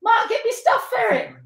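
high-pitched call to an assistant. Real, not acted. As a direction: call across the room, pitched right up. Calls out to assistant Mark.

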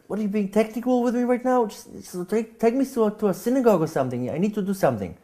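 can't believe he's being technical now; exasperated disbelief. Retold or imitated. Desperate self arguing with friend.

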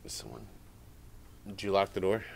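hushed. Real, not acted. Hushed exchange at the door.

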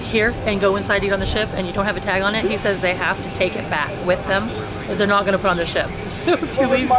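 ordinary talk, nothing marked

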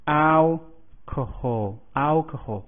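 alcohol said slowly, stress on the first syllable AL; slow pronunciation demo. Shown as a demonstration. Teacher models alcohol for students, slow and clear. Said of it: "重音节的第一个音节".

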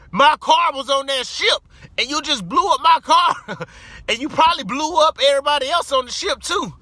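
high, loud indignant yell; retold outrage at the shooter over the car; outraged. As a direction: shout it at him, indignant.

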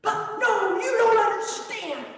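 high, loud, urgent objection; insistent protest. Acted. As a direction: cut him off, pleading to be heard. Disciples protest to Jesus.

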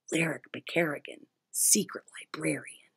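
hushed, whispered title; whispering. Acted. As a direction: breathe it as a secret. Refrain whispered.

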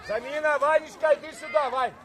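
loud shout across the pitch; calling out. Real, not acted. Coach summoning a player for substitution, calling out. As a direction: call the player over, loud.

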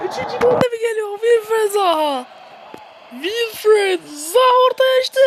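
high, loud commentary ending in an anguished cry; excited commentator. Pretend. Gamer commentating a near goal, crying out as it misses. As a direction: call the miss with real heartbreak.

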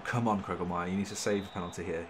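normal talking voice

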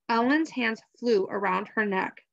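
usual speaking voice

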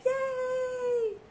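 high squealed yay; little squeal of joy; excited cheer. Real, not acted. Cheering over new Lego set.

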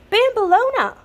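high, loud call; the witch calling urgently for her helper. A narrator's reading. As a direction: call her from across the room. Calling out to Bambolona.

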